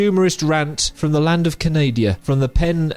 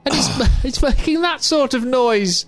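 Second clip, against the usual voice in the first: much higher, whiny put-on voice; squeaky mock celebrity complaint; 9 semitones higher than usual. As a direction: whine like a fed-up celebrity. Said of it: "that was my impression of [name]".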